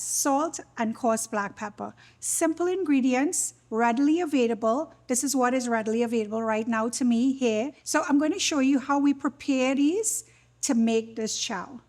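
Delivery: ordinary talk, nothing marked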